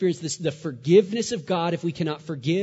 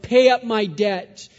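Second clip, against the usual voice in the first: loud, higher acted demand; angry demand; 5 semitones higher, 9 dB louder, slower than usual. Servant grabbing his debtor by the throat, angry demand. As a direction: demand payment loudly, words drawn out.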